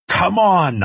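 high, loud shout of command; urgent yell. A narrator's reading. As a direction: yell to get them moving. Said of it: "I yelled at him".

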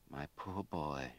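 slow and soft, a mother consoling; tender comfort. A narrator's reading. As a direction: hold him close with the words, slowly. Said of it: "she said".

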